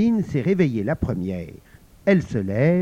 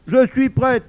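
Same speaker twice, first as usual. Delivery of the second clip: pouting, higher and louder; sulky; 9 semitones higher, 7 dB louder than usual. Acted. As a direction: say it with a sulk. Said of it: "d'un air boudeur".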